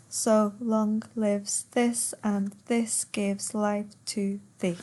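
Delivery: slow, syllable-by-syllable verse reading; per suku kata. Shown as a demonstration. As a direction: count out the line, one beat per syllable. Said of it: "a perfect ten syllables".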